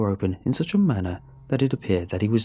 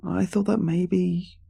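put-on slow, higher, uneasy boy voice; trailing off; 8 semitones higher, slower than usual. A narrator's reading. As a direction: let the words fade in dread. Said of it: "I trailed off".

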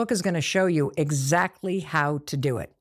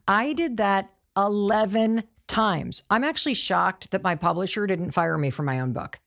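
normal talking voice

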